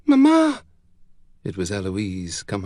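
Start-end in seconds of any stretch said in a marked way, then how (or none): 0.1-0.6 put-on high, loud little girl's cry; urgent, high-pitched child; child calling out. A narrator's reading. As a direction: call for mother in a high child's voice. Child calls her sobbing mother.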